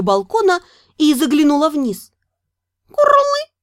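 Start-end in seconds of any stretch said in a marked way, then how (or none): 2.9-3.6 high exhaled creature call; high dragon sigh-chirp; breathed dragon chirp. Acted. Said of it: "выдохнул мой странный собеседник".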